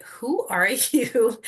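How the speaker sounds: high, slow, laughing "who are you"; amazed disbelief. Retold or imitated. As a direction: marvel at him with a laugh. Looked at him in disbelief.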